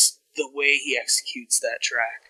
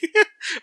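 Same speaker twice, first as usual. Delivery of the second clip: sudden high laugh; high burst of laughter; 5 dB louder than usual. Real, not acted. Laughs at own newscaster voice.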